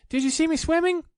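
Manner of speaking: very high, loud squeaky little voice; Roo squeaking. A narrator's reading. Roo showing off his swimming, high squeak. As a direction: squeak it like a tiny proud joey.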